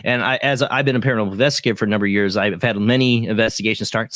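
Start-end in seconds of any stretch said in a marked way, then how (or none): none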